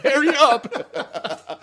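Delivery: high, drawn-out mock yell; hollered down at a dawdler, half laughing; dad calling out. Dad to son in the trench, mock impatient yell. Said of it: "my dad would be like".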